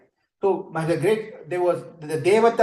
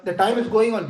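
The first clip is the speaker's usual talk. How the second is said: ordinary talk, nothing marked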